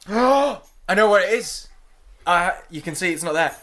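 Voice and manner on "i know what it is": gasp, then high, loud excitement; sudden realisation. Real, not acted. Co-host finally placing the cartoon voice, excited gasp of realisation. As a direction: burst out as it suddenly comes to you.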